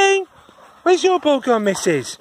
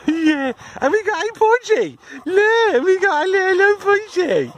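delighted pet talk; 8 semitones higher than usual. Real, not acted. Delighted at puppy.

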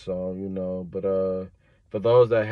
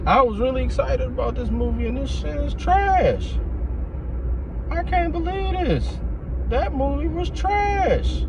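exasperated rant. Real, not acted. Disappointed fan ranting about movie.

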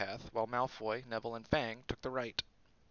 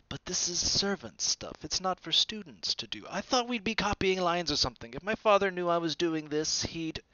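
put-on higher, whining rich boy; spoiled Malfoy; 7 semitones higher, 5 dB louder than usual. A narrator's reading. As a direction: complain like it's beneath you. Malfoy voiced, whiny high.

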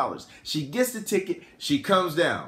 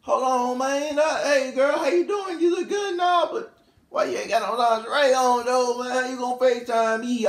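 high-pitched flustered protest; put-on high voice, complaining to his date; imitated rapper sweet-talking while objecting; Future; 9 semitones higher than usual. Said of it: "Future say".

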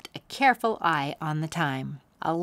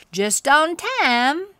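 higher, louder, slow drawn-out cowboy; cowboy uncle voice; 9 semitones higher, 7 dB louder, slower than usual. Acted. Cowboy uncle greeting the kids, slow and cheerful. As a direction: stretch it out like a cheerful old cowboy. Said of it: "said Cactus Joe".

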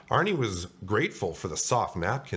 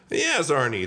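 high, loud hearty reply; jovial shop-owner voice; baker on phone; 7 semitones higher, 5 dB louder than usual. Acted. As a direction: answer brightly, pitched up. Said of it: "the baker answered".

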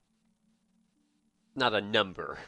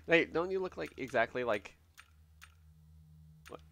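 ordinary talk

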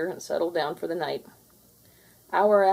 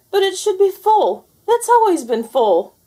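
indignant ghost; 14 semitones higher, 10 dB louder than usual. Acted. Ghost outraged at empty fridge.

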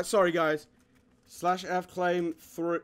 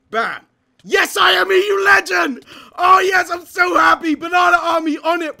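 high, loud excited shouting; hyped cheering; 9 semitones higher, 10 dB louder than usual. Real, not acted. Gamer cheering his teammate through a fight, shouting. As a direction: cheer the teammate on at full volume.

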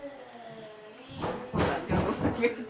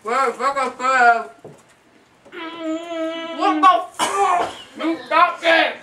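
mouth full of crackers; 4 semitones higher, 5 dB louder than usual. Real, not acted. Shouting mid saltine challenge, mouth stuffed.

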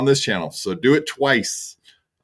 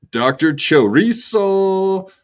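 put-on Latin accent; 11 semitones higher, 5 dB louder, slower than usual. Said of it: "doing it in a Latin, uh, dialect".

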